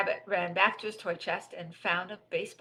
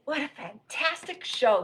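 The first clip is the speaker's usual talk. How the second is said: high, loud, slow gush; delighted enthusiasm; 7 semitones higher, 5 dB louder than usual. Acted. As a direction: stretch it out with delight. Rabbit delighted after TV show.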